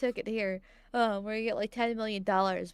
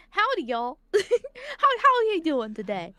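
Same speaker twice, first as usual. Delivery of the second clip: put-on Texan accent; 7 semitones higher, 6 dB louder than usual. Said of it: "do the accent correctly".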